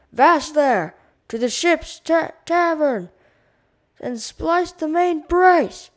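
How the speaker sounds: loud, high captain's roar. A narrator's reading. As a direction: bellow orders to stop the fight. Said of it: "Captain John Derby roared".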